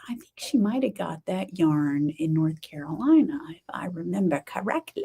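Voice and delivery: half-voiced whisper tucked in to the viewers; whispered aside. Real, not acted. Aside to viewers about the yarn, whispered. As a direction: drop to a secret whisper for the aside. Aside while talking to camera.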